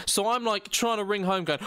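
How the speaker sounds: usual speaking voice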